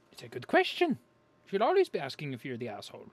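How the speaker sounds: high, loud mock sermon; mock proclamation. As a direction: proclaim it like a preacher. Mock preaching about the question.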